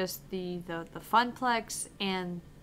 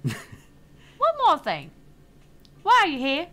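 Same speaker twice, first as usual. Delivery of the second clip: slow, louder and higher, pointed; a loud, deliberate boss voice; ominous interviewer; 6 semitones higher, 9 dB louder than usual. Acted. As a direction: pin him down with the question.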